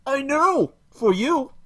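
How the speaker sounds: high, loud mocking put-on woman's voice; hammed-up parody of a preachy feminist YouTuber; parody Lacey Green. Streamer mocks Lacey Green to chat, high mocking voice. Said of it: "For I am Lacey".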